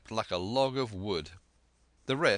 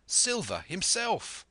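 high, drawn-out astonished cry; surprised cry leaping up in pitch; cry of surprise; 11 semitones higher, slower than usual. A narrator's reading. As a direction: exclaim it slowly in surprise. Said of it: "with a cry of surprise".

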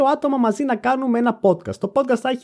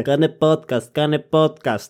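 lightly voiced pestering followers, pitched lower; mock nagging fans; 6 semitones lower, slower than usual. Imitating followers' DMs demanding an episode, mock nagging. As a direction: repeat it like an insistent demand, lightly mocking.

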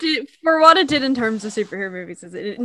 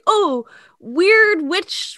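drawn-out spooky; 6 semitones higher, much slower than usual. Pretend. Savoring a spooky name.

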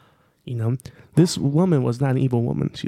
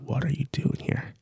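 hushed whisper; soft, breathy-whispered question from the dark; mysterious voice behind; 7 dB quieter than usual. Acted. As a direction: whisper it like an unseen stranger behind them. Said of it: "they hear a voice behind them".

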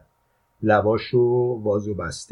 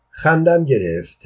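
plain reading voice

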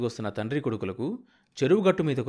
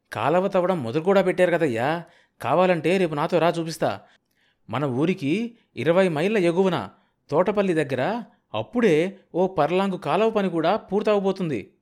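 higher, louder eager assurance; excited insistence; 5 semitones higher, 5 dB louder than usual. A narrator's reading. Kanakayya offering to show the canal works, excitedly insistent. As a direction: convince a doubter with keen energy.

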